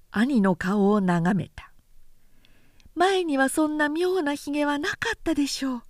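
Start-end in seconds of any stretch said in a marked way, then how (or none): 2.9-5.8 lightly raised, wondering sister voice; a sister puzzled at her brother's beard; Ichijo, the younger sister. A narrator's reading.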